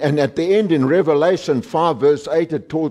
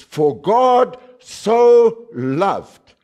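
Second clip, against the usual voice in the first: slow, raised delivery with SO stretched out; stress on SO; 5 semitones higher, 3 dB louder, much slower than usual. Shown as a demonstration. Preacher demonstrating the wrong emphasis, long heavy SO. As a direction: hammer and hold "so" before "loved". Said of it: "The wrong emphasis".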